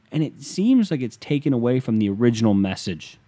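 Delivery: normal talking voice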